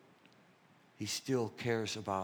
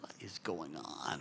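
hushed bewilderment; 5 semitones lower, 7 dB quieter, slower than usual. Retold or imitated. Disarmed seeing wife in tears.

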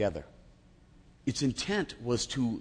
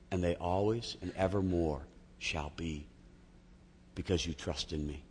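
gentle voice of God; 6 semitones lower, 10 dB quieter than usual. Retold or imitated. Voicing God's reply to him.

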